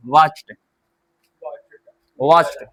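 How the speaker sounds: वॉच्ड ending in a light half t, watcht; teacher models the half t sound in वॉच्ड. Shown as a demonstration. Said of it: "आधा ट".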